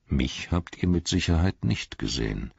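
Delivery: ordinary delivery, nothing marked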